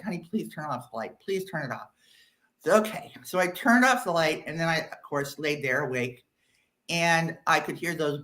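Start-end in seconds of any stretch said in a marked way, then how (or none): 0.0-1.9 sleepy mumble. Retold or imitated. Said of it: "he's like".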